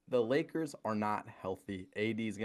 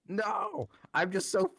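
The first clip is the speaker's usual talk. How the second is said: much higher, slow, fed-up whine; exasperated whine; 11 semitones higher, 4 dB louder than usual. Acted. Ranting at Clippers and Nets fans, high fed-up whine. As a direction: wail how sick of them you are.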